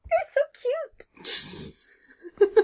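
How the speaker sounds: very high, drawn-out squeal through laughter; melting at how cute they are; gushing. Real, not acted. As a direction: gush in a tiny, slow, giggly squeak. Gushing over a cute couple.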